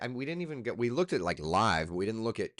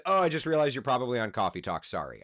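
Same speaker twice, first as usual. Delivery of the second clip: retold quick apology; 3 semitones higher, 7 dB louder, faster than usual. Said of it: "She said".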